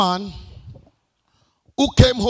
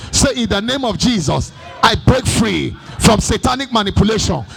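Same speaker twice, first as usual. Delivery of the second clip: loud fiery declarations over music; preaching at full force; 6 semitones higher, 7 dB louder than usual. Acted. Preacher leading a fiery declaration over music. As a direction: declare it, punching each line.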